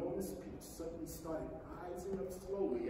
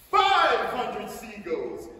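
loud, slow triumphant count; shout; 10 dB louder, much slower than usual. Acted. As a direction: call it out to everyone. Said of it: "James shouted".

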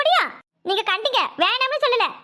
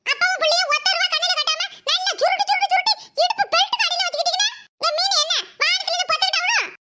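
higher, mocking kid's voice; cheeky boy voice; 6 semitones higher than usual. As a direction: sass the grandfather like a bratty boy. Son Subi mocks grandfather.

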